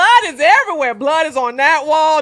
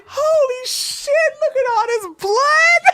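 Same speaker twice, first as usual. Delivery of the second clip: squealed mock horror; shocked exclamation; 13 semitones higher than usual. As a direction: imitate a shocked partner, cracking up. Acted shocked reaction at scene.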